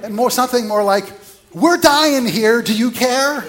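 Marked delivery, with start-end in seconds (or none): 1.5-3.4 much higher and louder, sarcastic and indignant; sarcastic indignation. Retold or imitated. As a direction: fling the complaint at him, sarcastic and outraged. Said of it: "A little bit of sarcasm in that".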